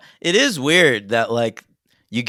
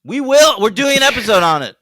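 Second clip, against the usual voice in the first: insistent; 10 semitones higher, 7 dB louder than usual. Real, not acted. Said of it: "insisting they'll cover it".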